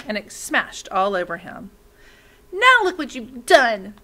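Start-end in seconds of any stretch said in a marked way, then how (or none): 2.5-4.0 loud, high angry scream; shrill-pitched furious boy's scream. Acted. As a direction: yell it like a furious boy. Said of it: "Torin screamed".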